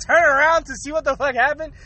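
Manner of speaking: ordinary delivery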